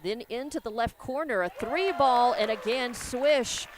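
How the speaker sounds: loud call as the three drops; rising excitement on the swish; excited. Real, not acted. Second made three in the corner.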